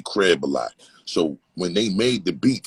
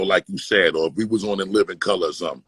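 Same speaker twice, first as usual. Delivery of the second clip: ordinary talk, nothing marked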